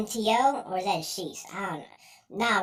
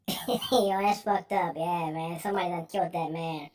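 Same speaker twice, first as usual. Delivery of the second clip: normal talking voice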